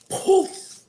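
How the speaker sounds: high, loud puff of a spell; sudden airy "poof" sound effect; magic poof. Acted. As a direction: pop it out like a spell going off.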